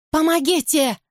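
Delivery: high terrified cry for help; scream for help. A narrator's reading. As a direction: scream it out of a nightmare. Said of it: "Это кричу я".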